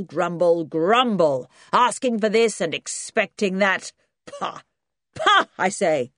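put-on loud commanding old grandad. Grandad scolding young people about work, loud and gruff. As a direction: bellow like a cranky old farmer. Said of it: "A loud, commanding voice".

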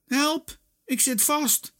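high cry for help; calling out. A narrator's reading. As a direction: call out stuck and helpless. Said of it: "roept hij".

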